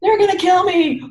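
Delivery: high, loud mock terror; hammed-up panic over being called a healer. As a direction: play up the fear, loud and high. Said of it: "mock panic in retelling".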